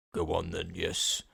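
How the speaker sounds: low, quiet mock accent; a lightly done bad northern accent; a hint of a gruff producer's accent; terrible accent. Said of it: "that's a terrible accent".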